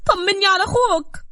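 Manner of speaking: high, tearful mother's plea; anxious plea. A narrator's reading. Mother begging her son for news of his brother, anxious plea. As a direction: beg for news, voice high and loud.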